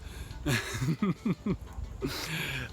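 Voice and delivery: quiet real chuckle; short soft laugh. Real, not acted. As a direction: laugh gently at the comment. Laughs at viewer comment.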